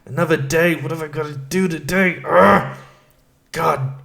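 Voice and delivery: exasperated morning rant, ending in a growl; angry grumbling. Retold or imitated. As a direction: groan and curse at another day. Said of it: "Just angry".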